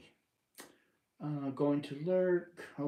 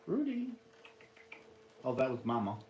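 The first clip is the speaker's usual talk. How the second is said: ordinary talk, nothing marked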